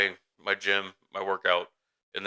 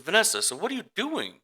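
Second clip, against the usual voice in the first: surprised questioning; 11 semitones higher than usual. Retold or imitated. Mother retelling finding her daughter out of the room, surprised questioning. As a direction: retell a parent's puzzled question, pitched way up.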